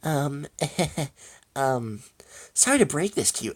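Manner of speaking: high, hesitant Double D voice; timid stammering start with a nervous little laugh; a little shaky. Acted. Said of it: "little shakily".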